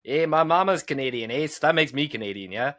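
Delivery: put-on light jokey Canadian accent, making fun of the heritage; lightly voiced Canadian "eh" accent, teasing; hammed-up Canadian accent, a touch only; mock Canadian accent. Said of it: "You're making fun of my people".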